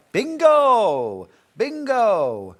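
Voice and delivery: loud, high, drawn-out shout; calling out bingo. Pretend. Host playfully calling bingo on stream, loud drawn-out shout. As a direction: holler it like a winner in a bingo hall.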